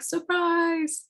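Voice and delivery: high, squeaky pop-up toy cry; jack-in-the-box surprise. Retold or imitated. Jack-in-the-box jumps out at nobody, high and gleeful. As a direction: burst out like a toy springing from its box. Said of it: "he's like".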